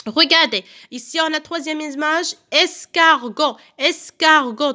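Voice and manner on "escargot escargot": "escargot" slow, in three beats; syllable by syllable. Shown as a demonstration. Teacher counting syllables for pupils, slow and segmented. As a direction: separate each syllable of "escargot". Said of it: "trois syllabes".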